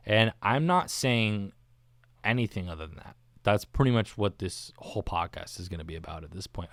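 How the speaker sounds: ordinary talk, nothing marked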